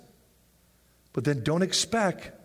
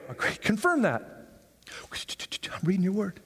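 whispered aside. Acted.

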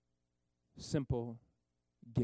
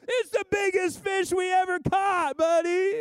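high, loud, slow thrilled yell; excited shout; 8 dB louder than usual. Retold or imitated. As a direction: shout it like a fisherman's big catch. Disciple cheering at huge fish.

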